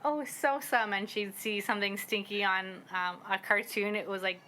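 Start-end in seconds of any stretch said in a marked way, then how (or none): 0.0-0.9 a touch higher, a mock disgusted parent saying the word; parent voicing the word. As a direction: say it like you're teaching a toddler. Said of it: "we'd always be like".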